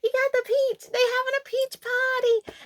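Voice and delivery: put-on high, giddy child cheer; squealing little kid delight; excited kid cheering. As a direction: cheer like a kid loving the movie. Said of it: "I'm like".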